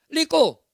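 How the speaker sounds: faithful copy of the faulty "rico"; son repeating mistake. Acted. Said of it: "Por eso hijo re-, repite".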